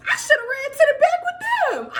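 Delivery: very high, comic whine; regretful wail. Retold or imitated. Regretful wail at her mistake.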